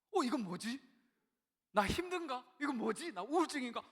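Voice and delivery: high, loud, alarmed bewilderment; suddenly shocked at his own tears; startled self-question. Retold or imitated. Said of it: "막 너무 놀란 거예요".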